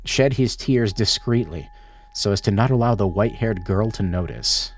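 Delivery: low, measured storybook narration; story narration. A narrator's reading. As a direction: read it like a quiet tragic tale. Narration of game text.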